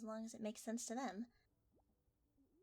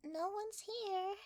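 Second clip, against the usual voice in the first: startled; 8 semitones higher, 6 dB louder, slower than usual. Acted.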